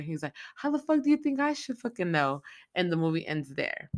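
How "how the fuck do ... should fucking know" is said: much higher, incredulous snap; exasperated retort. Retold or imitated. Sheriff answering the deputy, high exasperated retort. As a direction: act like it's a stupid question.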